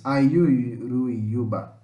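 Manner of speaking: Ayuruyuba said very slowly, vowels gliding together in one breath; slow demo pronunciation. Shown as a demonstration. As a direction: stretch out Ayuruyuba slowly, all vowels joined. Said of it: "Tudo junto, ó. As vogais são juntas". (pronúncia lenta de Ayuruyuba, vogais juntas)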